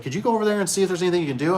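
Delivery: high, rushed plea, a lightly voiced worried lady; a hint of a worried homeowner asking for help; lady caller, quick. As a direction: rattle off the request fast and high. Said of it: "And she's like".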